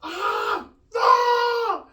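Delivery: high loud yelps of pain; pained cries. Real, not acted. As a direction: cry out in pain. Pain from nasal rinse.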